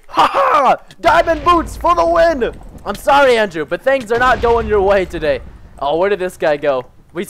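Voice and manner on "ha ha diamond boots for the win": gloating triumph. Real, not acted. Gloating over crafted diamond boots.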